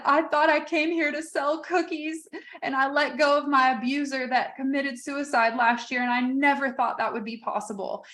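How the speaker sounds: a hint of a young woman choked up; emotional young woman. Young vendor tells the speaker why she's crying, lightly tearful.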